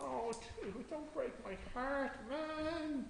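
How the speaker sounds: high, soft, pleading mock dismay; mock heartbreak. Teasing student over basic mistake.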